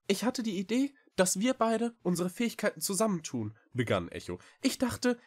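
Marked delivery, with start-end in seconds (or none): 0.1-3.6 very high, quick small-creature voice; little cat Echo, earnest. A narrator's reading. Cat proposes idea. 4.6-5.2 high-pitched small cat voice; little cat. A narrator's reading. Cat starts explaining.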